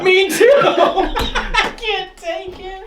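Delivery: high squeaky giggle, slowed; Mickey Mouse laugh. Real, not acted. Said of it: "my laugh is like Mickey Mouse".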